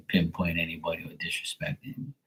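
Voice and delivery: a whispered quick aside; hurried whisper. Real, not acted. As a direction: rush it under the breath. Hurried quiet disclaimer.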